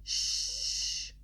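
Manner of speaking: high unvoiced shush. A narrator's reading. Pinky hushing Twilight: sharp high shush. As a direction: hush her quickly. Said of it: "she said, quickly putting a finger".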